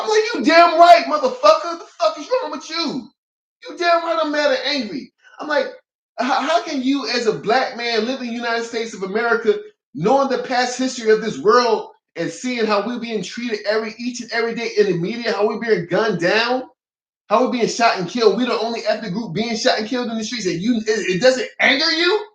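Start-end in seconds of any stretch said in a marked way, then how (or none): 0.4-5.1 high-pitched furious retort, shouted at first; flipping out. Retold or imitated. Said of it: "I was flipping it". 6.2-22.3 high-pitched, incredulous angry challenge; flipping out. Retold or imitated. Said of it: "I was flipping it".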